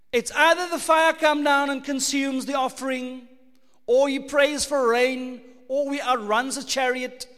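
loud, driving preaching; preaching with force. Acted. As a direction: build it up like a revival preacher. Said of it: "preaching at full force".

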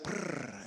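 low rolled purring trill; imitated cat purr. Imitating a cat being petted.

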